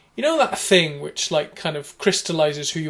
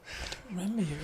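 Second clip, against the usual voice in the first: stunned hush; 4 semitones higher, 11 dB quieter than usual. Retold or imitated. Stunned quiet reply.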